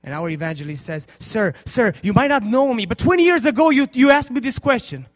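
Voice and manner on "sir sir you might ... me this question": loud and high, pleading; fervent preached quote. Acted. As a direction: call out to the old man with fervour. Evangelist addresses dying man.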